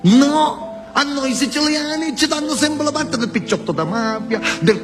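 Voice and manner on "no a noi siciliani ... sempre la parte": high sharp protest; indignant no. Real, not acted. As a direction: cry out in protest. Indignant outburst.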